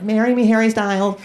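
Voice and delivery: put-on high squealing teen girl; a high swooning teenage fan; teen fangirl. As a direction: beg like a starstruck thirteen-year-old. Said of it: "thirteen-year-old girls and they're like".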